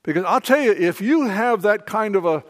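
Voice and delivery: loud, driving sermon delivery; preaching at full force. Acted. Pastor urging the congregation, loud full-force preaching. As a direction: preach it with conviction to the room.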